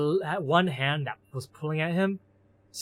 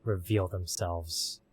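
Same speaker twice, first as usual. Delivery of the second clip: ominous narration; 5 semitones lower, 4 dB quieter than usual. A narrator's reading.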